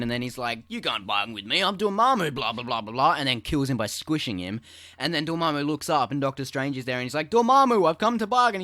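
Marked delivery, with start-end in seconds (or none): 0.7-3.2 hammed-up booming villain, lightly done; mock villain voice. Voicing villain Dormammu. 7.3-8.6 higher, louder hammed-up hero; mock heroic declaration. Voicing Strange again.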